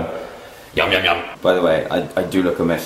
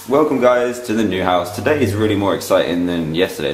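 usual speaking voice

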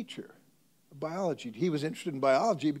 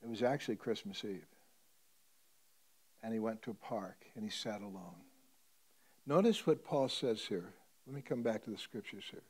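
ordinary delivery

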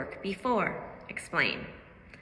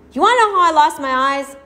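loud, higher bitter outcry; the blind man cries out his pitch; crying out; 8 semitones higher, 14 dB louder than usual. A narrator's reading. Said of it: "cried the man".

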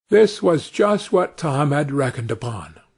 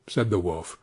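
ordinary delivery, nothing marked

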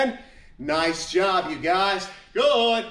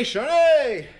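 calling out; 7 semitones higher, 5 dB louder than usual. Real, not acted. Calling out a student's name.

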